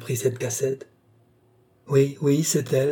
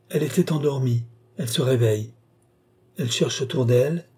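ordinary delivery, nothing marked

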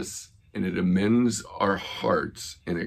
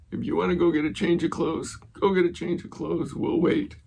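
higher-pitched, quick retold urging; eager urging; 10 semitones higher, faster than usual. Pastor urging a man to go change before baptism, eager. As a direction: send them off quickly, in a higher voice.

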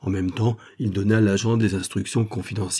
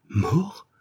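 a much higher, startled question, shivering; shocked cry; 10 semitones higher than usual. A narrator's reading. The girl hearing the man is dead, shocked cry. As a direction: gasp the word out in disbelief. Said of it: "s'écria la jeune fille en frissonnant".

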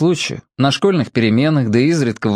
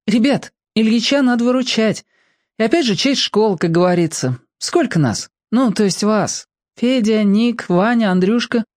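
put-on high girl voice; 10 semitones higher than usual. Girl character rallying friends.

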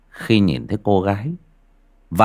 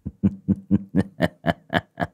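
cold laugh; 4 dB quieter than usual. A narrator's reading. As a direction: chuckle coldly in a string of short bursts. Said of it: "mới cất giọng lạnh lẽo".

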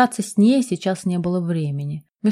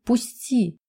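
crying; 4 semitones higher, 4 dB quieter than usual. Acted. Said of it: "плача, напуганный напором мужчины".

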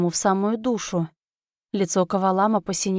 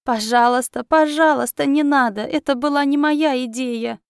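higher and louder, frantic begging cry; desperate scream; 5 semitones higher, 4 dB louder than usual. Acted. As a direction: scream for mercy in terror. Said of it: "резко закричал".

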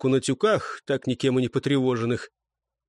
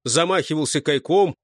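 plain reading voice

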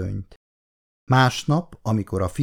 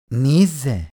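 flattering; 2 semitones higher than usual. A narrator's reading. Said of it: "mondta hízelgő hangon".

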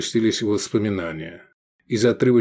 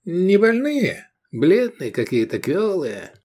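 doubtful haggling; 8 semitones higher, slower than usual. A narrator's reading. Buyer belittling the slaves on offer, doubtful. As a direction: pick fault to beat the price down.

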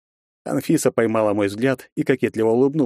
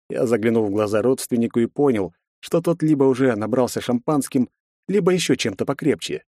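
usual speaking voice

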